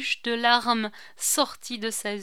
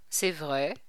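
a lower, grave priestly answer; 5 semitones lower than usual. A narrator's reading. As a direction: answer with calm authority. (le prêtre répond, grave et plus bas)

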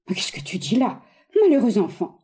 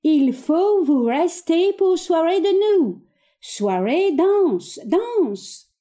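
put-on high, slow pidgin French in an English accent; broken french with English accent; 7 semitones higher, much slower than usual. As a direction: mimic an English accent to be understood. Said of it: "avec l'accent anglais".

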